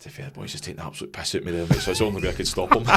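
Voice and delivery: quoting him through laughter, fast; mock his excuse while cracking up; laughing quote. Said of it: "He's like".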